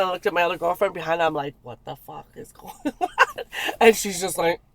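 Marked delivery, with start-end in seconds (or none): 1.6-3.4 slow, low, breaking into a laugh; laughing disbelief. Retold or imitated. Turning to her other girlfriend behind, amused disbelief. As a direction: let the retold disbelief crack into laughter.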